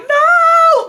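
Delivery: high, loud wailed denial; hammed-up tearful howl; mock crying. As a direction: squeal it in teenage despair. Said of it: "I am crying".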